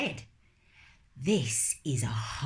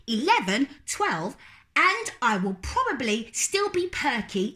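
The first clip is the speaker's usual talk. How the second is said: put-on little girl refusing; 8 semitones higher than usual. Lola refusing to go to bed, high and stubborn. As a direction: dig in like a stubborn small child.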